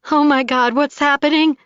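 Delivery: frightened cry for help; panicked. A narrator's reading. Collapsing on the floor.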